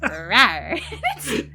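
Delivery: playful cat rawr, laughing; a cute little roar; bouncy playful with animal noises. Pretend.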